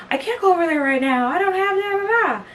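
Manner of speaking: high, fast, whiny protest; panicky boyfriend. Retold or imitated. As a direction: squeak it out like a flustered boyfriend. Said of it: "And he was like".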